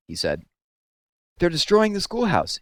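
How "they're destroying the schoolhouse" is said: much higher, gasping urgency; out of breath from running, blurt it out; panicked breathless plea. A narrator's reading. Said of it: "gasping for breath".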